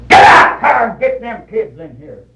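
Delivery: loud, high opening yell, easing off; mock shrieked order to a husband; imitated squall. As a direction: squall it like a scolding wife. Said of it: "squalled at him".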